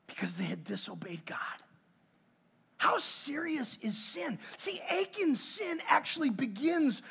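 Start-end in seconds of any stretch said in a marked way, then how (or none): 0.1-1.4 hushed, grave whisper. Real, not acted. Preacher telling the congregation why they lost, grave whisper. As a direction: drop to a whisper for weight. Solemn dramatic aside.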